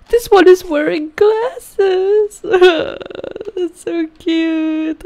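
very high, slow squealing coo with a giggle; dying of cuteness. Real, not acted. Player seeing a baby in glasses, squealing coo. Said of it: "I'm dying".

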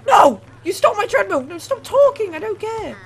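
high, loud acted protest; mock outraged yelling. Game character fighting over a treadmill, mock outraged yelling. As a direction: play up a petty squabble at full voice.

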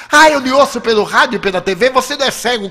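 high, loud, quick objection; retold indignant protest. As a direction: protest as if catching out a liar. Stranger indignantly disputes the blindness claim. (protesto indignado, agudo e acelerado)

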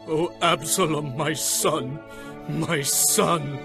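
subdued, grieving moan; weeping.

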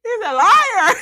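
high, loud wailing mock sob; hammed-up crying shout; mock crying scream. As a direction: wail it like a tantrum. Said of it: "Martell screamed".

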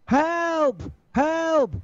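high, thin mock cry for help; old lady crying for help. Drowning old lady calling to him for help, high mock cries. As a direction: imitate a drowning woman's calls. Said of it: "I hear".

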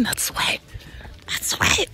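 a hushed punchline, dead serious; whispered joke. Pretend. Vlogger whispers to viewers it's just sweat. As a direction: whisper it, unvoiced and close.

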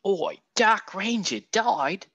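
slow, high hammed-up Aussie 'Oi'; mock Australian accent. Painter repeats the hero's news in a hammed-up Australian accent. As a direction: drawl it out like a cartoon Australian.

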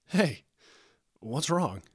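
loud 'hey' easing into concern; concerned question; losing happy tone. A narrator's reading. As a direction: check on a friend, cheer fading. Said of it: "his voice losing the happy tone".

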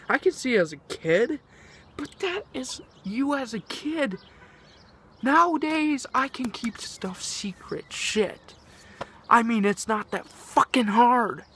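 high and loud, building outrage; heated rant. Real, not acted. To viewers about blabbing, rising angry rant. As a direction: blow up about it. Venting about keeping secrets.

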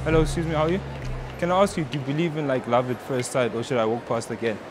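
usual speaking voice

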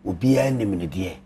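normal talking voice

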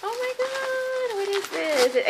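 amazed at what's in the box; surprised. Real, not acted. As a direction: draw it out in wonder. Surprise at new item.

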